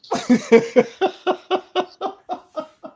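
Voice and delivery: real, high, loud burst of laughter; long laugh. Real, not acted. Friend laughing at the prankster's confession of trouble, real high laughter. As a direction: laugh high and helplessly, running on.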